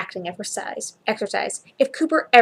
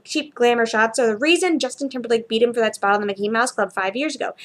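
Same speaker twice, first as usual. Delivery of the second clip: plain reading voice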